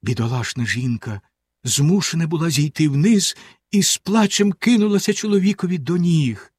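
ordinary delivery, nothing marked

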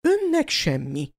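grudging praise, a touch of irritation; half annoyed, half admiring. Acted. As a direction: McGonagall to Dumbledore, half cross, half admiring. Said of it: "félig bosszús, félig elismerő hangon".

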